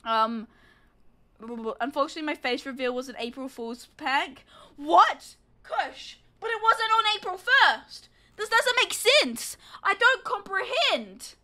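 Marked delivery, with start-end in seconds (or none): 4.8-11.3 mock disbelief, pitched high; sarcastic shock. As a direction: feign bewilderment in a high, drawn-out protest. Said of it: "insert sarcasm".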